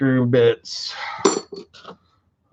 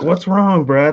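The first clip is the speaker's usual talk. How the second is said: coworkers asking; 8 semitones higher, 4 dB louder than usual. Retold or imitated. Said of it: "They're like".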